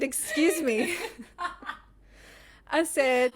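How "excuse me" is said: high, very loud comic outrage; hammed-up storming confrontation; mock indignation. Wife marching up to mother-in-law, loud mock indignation.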